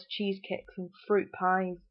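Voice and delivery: usual speaking voice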